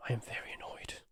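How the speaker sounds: a hushed, unvoiced stage whisper; mock whisper. Streamer teasing chat about interruptions, in a whisper. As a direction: breathe it out with no voice, teasing.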